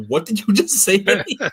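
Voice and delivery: incredulous boss. Retold or imitated. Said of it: "she goes".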